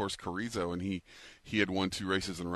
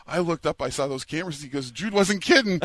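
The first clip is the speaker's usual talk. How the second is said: excited retelling; 8 semitones higher, 7 dB louder than usual. Retold or imitated. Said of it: "He says".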